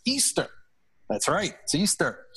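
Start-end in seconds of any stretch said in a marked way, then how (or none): 0.0-0.5 confident, pitched-up answer. Retold or imitated. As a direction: blurt it out sure of yourself. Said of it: "they said with confidence".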